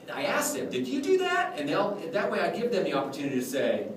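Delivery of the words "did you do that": high-pitched incredulous question; mock accusing surprise. Clown asking the kid about the wand, mock amazed. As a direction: ask it high, as if amazed at them.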